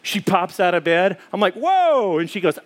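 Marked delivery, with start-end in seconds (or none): 1.5-2.2 high, drawn-out mock surprise; playful startled exclamation. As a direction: act taken aback, voice jumping up. Father startled as daughter pops up.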